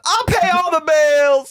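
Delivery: very high, loud mock wail; hammed-up high-pitched outraged yell; Brittany imitation. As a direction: shriek it as her, drawn out. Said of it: "She goes".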